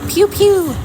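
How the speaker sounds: high, loud mock pew pew; playful zap noise. Mom mocking vaccine shots with a playful zap noise. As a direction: zap it out like a toy ray gun.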